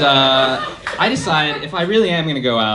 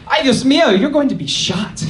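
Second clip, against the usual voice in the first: a much higher, louder mock-panicked mother's cry; panicked mom; 12 semitones higher, 5 dB louder than usual. Colombian mom fearing for her son, mock-panicked louder wail. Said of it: "more along the lines of".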